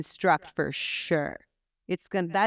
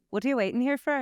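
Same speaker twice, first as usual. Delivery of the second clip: lilting Irish accent, raised pitch; 5 semitones higher, faster than usual. Shown as a demonstration. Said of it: "So for Irish".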